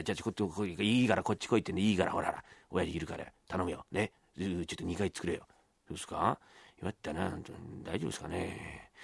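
hushed, low conspiratorial exchange; secretive. Acted. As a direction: keep it down so nobody downstairs hears. Sneaking upstairs past the father.

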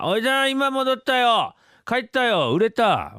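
put-on high, slow dim-witted young man; simple Yotaro. Yotaro calling to his uncle that everything sold, high and slow. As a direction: proudly announce you're back, drawn out. Rakugo Yotaro returns home.

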